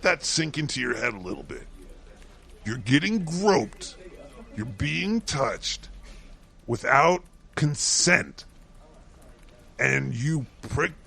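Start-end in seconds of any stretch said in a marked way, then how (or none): 2.6-8.4 each phrase laid out slowly; slow, pointed build-up; slow and deliberate. Real, not acted. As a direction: spell it out slowly for emphasis. Outraged recap of the article.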